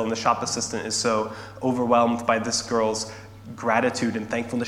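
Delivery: ordinary talk, nothing marked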